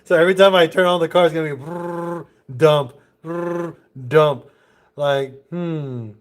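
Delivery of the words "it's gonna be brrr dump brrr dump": mimicking a rough engine start; sputtering motor noise with the mouth; engine sputter imitation.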